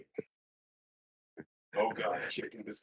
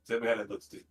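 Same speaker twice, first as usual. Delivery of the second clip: ordinary delivery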